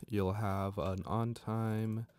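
ordinary talk

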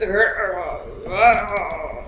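mimicked grunting noises in another voice; mock another player's noises; imitating another player. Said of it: "He said".

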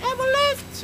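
high-pitched mock screech; retold screaming. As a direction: cry out high like a screaming old woman. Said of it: "She was screaming like".

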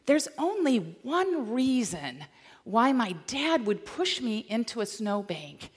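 usual speaking voice

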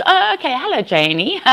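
"Janie" pitched high then dropped, Aussie-style; mock Australian greeting; Aussie mispronounced Janie. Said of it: "everybody goes".

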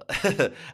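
short high, loud laugh. Real, not acted. Host amused by his own name for the episode, laughing. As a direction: burst out in a brief chuckle.

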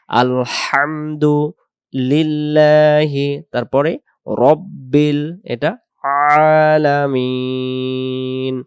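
slow chant for students to follow; recite along. Shown as a demonstration. Teacher leading students to recite along slowly. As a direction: recite slowly so they repeat after. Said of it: "আমার সাথে সাথে".

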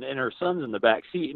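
ordinary talk, nothing marked

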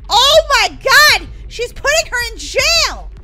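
loud, slow, shocked outcry; shocked cry. Acted. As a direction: gasp it out in disbelief. Shocked at a jailing in game.

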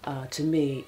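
usual speaking voice

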